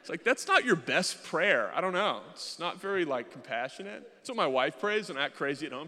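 normal talking voice

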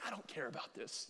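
soft, dismissive tough-guy act. Retold or imitated. Husband acting tough during wife's movie, dismissive. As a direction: shrug it off quietly like it's nothing. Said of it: "tried to act like a tough guy".

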